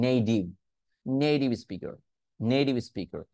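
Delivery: "নেটিভ" as "nei-div"; flap T. Shown as a demonstration. Teacher answering with the model pronunciation, flap T. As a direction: soften the T of "নেটিভ" to a quick D. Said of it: "নেটিভকে আমরা কি বলতে পারি".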